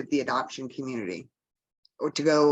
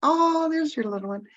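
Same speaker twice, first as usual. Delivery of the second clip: delighted cooing; 12 semitones higher than usual. Real, not acted. Colleague spotting the speaker's little grandson, cooing high. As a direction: coo sweetly, pitched way up.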